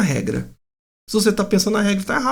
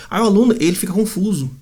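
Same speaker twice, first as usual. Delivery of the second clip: ordinary talk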